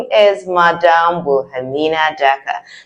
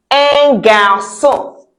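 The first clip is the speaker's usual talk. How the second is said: slow, higher demo for repeating; slow model pronunciation; 5 semitones higher, much slower than usual. Shown as a demonstration. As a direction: stretch each syllable for learners. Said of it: "a boy in French is un garçon".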